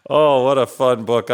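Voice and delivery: plain reading voice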